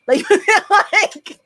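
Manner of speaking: breaking into a real laugh. Real, not acted. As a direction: laugh through the words. Laugh heard.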